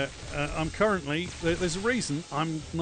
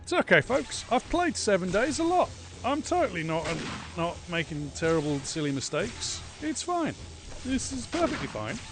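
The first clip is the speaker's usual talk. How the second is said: mock reassurance; 7 semitones higher, 4 dB louder than usual.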